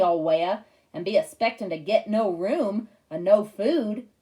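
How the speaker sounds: loud, slow Southern hillbilly drawl; mock hillbilly Southern sheriff, loud; hillbilly accent. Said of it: "Dad did an imitation of a hillbilly accent".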